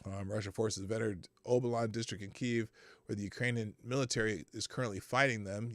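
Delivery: ordinary talk, nothing marked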